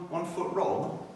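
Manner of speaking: normal talking voice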